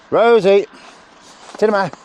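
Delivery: high, loud shout of the dog's name; calling out. Real, not acted. Trainer shouting the dog's name across the field.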